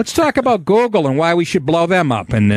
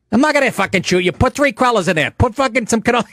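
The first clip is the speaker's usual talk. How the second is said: higher mock mobster sneer; Christopher; 8 semitones higher than usual. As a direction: needle the kid like a wiseguy. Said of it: "He pulls a gun".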